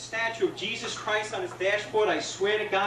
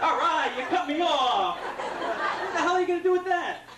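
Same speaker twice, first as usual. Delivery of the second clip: mock driver taunt; 7 semitones higher than usual. Comic yelling at the driver who cut him off, mock taunt. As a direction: jeer at him for cutting you off.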